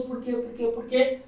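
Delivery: high, repeated pressing question; re-enacted nagging insistence; insistent questioning. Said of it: "E aí eu insistindo".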